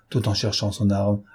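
plain reading voice

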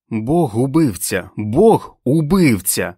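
loud, drawn-out accusation, rising on the repeat; angry cry. A narrator's reading. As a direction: hurl it out slowly and furiously. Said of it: "гнівно викрикувала Френ". (гнівний, протяжний вигук)

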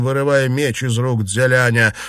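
ordinary delivery

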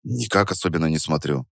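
low, muted denial; offhand low-voiced brush-off; evasive denial. A narrator's reading. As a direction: lie quietly, eyes on the work. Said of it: "соврал я".

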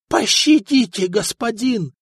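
dry-throated, rasping plea; hoarse plea. A narrator's reading. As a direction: beg through a parched throat. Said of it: "прохрипел ростовщик пересохшим горлом". (хриплая мольба)

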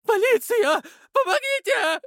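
shrill panicked scream for help; terrified screaming. Acted. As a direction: scream it in terror, very high. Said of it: "вопил самый толстый". (испуганный визг)

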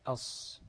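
أص with a sustained heavy ص; lam merged into sad. Shown as a demonstration. Teacher insisting there is no lam, أص with long ص. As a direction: stretch the s in أص, no L at all. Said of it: "مفيش لام".

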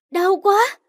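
high pained cry; sharp pained yelp. Acted. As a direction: cry out as the pain hits. Narrator falls, hurt belly.

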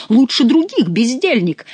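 angry exclamation. A narrator's reading. King scolding his jester Chicot, mild angry exclamation. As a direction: scold the idler. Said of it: "воскликнул король".

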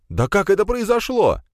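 very high, bewildered cry; panicked protest. A narrator's reading. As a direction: wail the question in alarm. Dragged Pegasov's panicked protest.

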